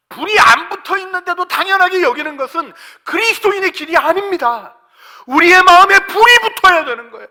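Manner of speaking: very high, shouted pulpit exhortation; full-force preaching. Real, not acted. Preacher urging congregation to burn in spirit, full-force shouted preaching. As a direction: cry out to set their hearts on fire.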